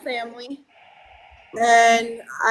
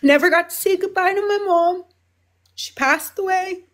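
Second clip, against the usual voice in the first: real emotion, higher and close to crying; grieving, higher voice; 5 semitones higher than usual. Real, not acted. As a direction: voice pitched up with held-back tears. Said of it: "excuse me while I get emotional".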